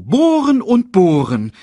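high, loud, drawn-out bird voice; bird secretary's complaint. Acted. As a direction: stretch out the tedious complaint slowly. Sasu's report continues in character.

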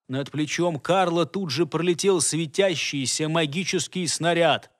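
usual speaking voice

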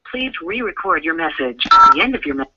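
usual speaking voice

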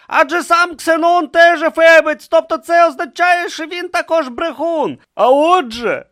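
loud, high, heated argument; agitated high-pitched squabbling; quarrelling shouts. Acted. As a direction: shout over your rival in protest. Acted shouting match between philosophers.

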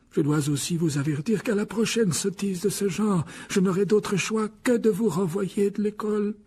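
measured warning to pupils; grave headmaster. A narrator's reading. The headmaster warns the boys, gravely. Voices Dumbledore.

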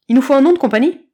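loud, eager announcement; exclaiming kitten. A narrator's reading. Kitten turning to the others, loud and eager. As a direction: burst out with the idea. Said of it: "s'exclama soudain Chaton".

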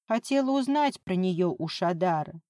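usual speaking voice

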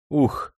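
a short exhaled sigh of relief; relieved sigh. A narrator's reading. Officer safe after fleeing, relieved sigh. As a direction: let out a breath. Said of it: "вздохнул Демидов".

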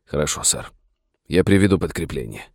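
subdued, halting assent; despairing submission. A narrator's reading. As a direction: give in reluctantly to the order. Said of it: "поднял глаза, полные отчаяния, и вымолвил".

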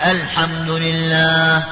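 الحمد لله slowly chanted, with an a vowel at the start, alḥamdu; correct alḥamdu. Shown as a demonstration. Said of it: "هذا خطأ".

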